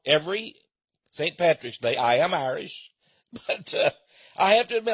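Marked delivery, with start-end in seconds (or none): none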